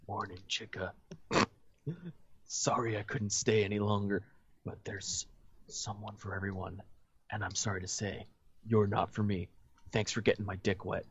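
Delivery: low, hushed murmur to a sleeper; whispered goodbye. A narrator's reading. Man leaving the sleeping girl he slept with, whispering. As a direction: speak softly and low, barely above a whisper. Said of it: "he smiled down at her and whispered".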